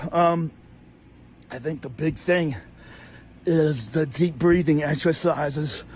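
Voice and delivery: ordinary talk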